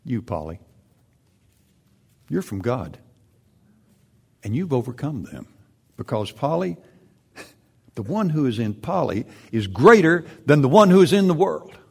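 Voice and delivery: personal reading. Shown as a demonstration. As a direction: read it to her by name, growing joyful. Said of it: "You ask a friend to read that to you".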